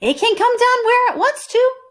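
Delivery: high bright request; Amelia Bedelia voiced. Amelia Bedelia asking the boys for help, high. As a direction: ask brightly. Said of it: "said Amelia Bedelia".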